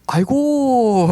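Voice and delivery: high, drawn-out aching wail of pity; pitying cry. Retold or imitated. Doctor calling to a weeping stranger, long pitying aigo. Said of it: "제가 본능적으로".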